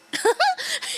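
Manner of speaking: shrill burst of laughter up high; high squealing laugh. Real, not acted. Streamer squealing with laughter at the game. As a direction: squeal with delight.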